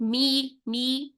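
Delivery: می drawn out slowly, voice sloping down; slow thin mee. Shown as a demonstration. Teacher isolating the thin mee. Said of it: "آواز کا جھکاؤ proper نیچے کی طرف ہو".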